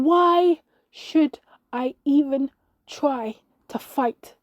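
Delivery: loud, slow, each word punched out apart; annoyed outburst. A narrator's reading. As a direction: throw it back in exasperation. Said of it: "I got annoyed at that voice".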